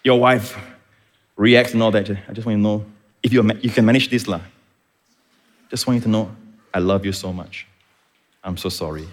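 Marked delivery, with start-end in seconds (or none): 2.1-9.1 soft, low soothing husband; tender apology. Acted. Preacher acts a husband calming his upset wife, softly apologetic. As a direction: soften right down and apologize gently.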